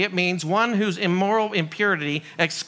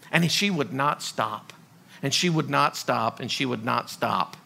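each repeat quieter and lower; fading repetition; 9 semitones lower, 4 dB quieter than usual. Shown as a demonstration. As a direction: let it sink softer each time. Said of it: "repeated three times, fading".

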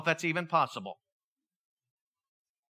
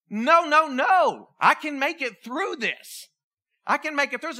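higher-pitched, insistent protest; pleading resolve, pitched up; desperate determination; 5 semitones higher, 4 dB louder, slower than usual. Acted. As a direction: act out a recruit refusing to quit. Recruit refusing to be recycled.

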